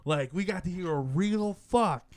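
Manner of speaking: loud, high mock excitement; imitated awe. Voicing Japanese players thrilled by real swearing, mock excitement. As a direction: gush as a thrilled listener, hammed up. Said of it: "they're just like".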